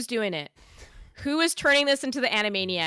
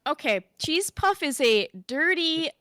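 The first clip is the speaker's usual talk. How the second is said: ordinary talk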